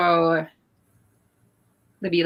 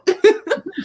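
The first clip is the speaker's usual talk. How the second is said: high giggle; 12 semitones higher, 4 dB louder than usual. Real, not acted. Laughing at the side eye joke.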